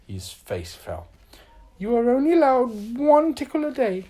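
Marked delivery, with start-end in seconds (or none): 1.8-4.1 high, loud ruling; firm condition. A narrator's reading. Little Miss Magic sets Mister Tickle a limit, high firm voice. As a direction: lay down the rule.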